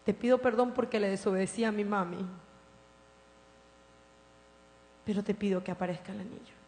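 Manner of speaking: very soft, hushed pleading; a frightened girl begging God softly; soft weeping plea from a little girl; child's tearful prayer. Retold or imitated. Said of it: "llorando le decía".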